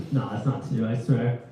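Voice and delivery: ordinary delivery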